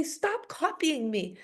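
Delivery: drawn-out, mock-irritated child's complaint; annoyed sibling. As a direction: complain slowly like a pestered sibling, lightly acted. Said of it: "it gets very annoying".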